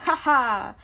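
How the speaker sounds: loud gloating ha ha; mocking laugh. A narrator's reading. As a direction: laugh in triumph at the captive. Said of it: "The sheriff laughed".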